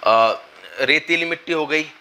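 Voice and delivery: ordinary talk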